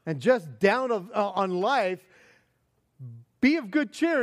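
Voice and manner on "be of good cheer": bright lift on cheer; upbeat and encouraging; cheerful emphasis. Shown as a demonstration. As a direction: sell the cheer with a higher voice. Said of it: "as opposed to being gloomy".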